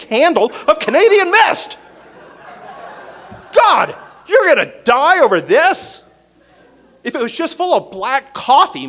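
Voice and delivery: very high, loud exasperated rant; incredulous rant. Retold or imitated. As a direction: scold him for picking cheap bourbon. Ranting at friend over cheap bourbon.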